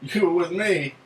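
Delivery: real disbelief at the news; surprised. Real, not acted. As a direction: caught off guard, voice jumping up. Host learns he was present.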